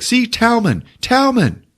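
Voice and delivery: high eager character voice; high excited voice. Retold or imitated. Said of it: "he's like".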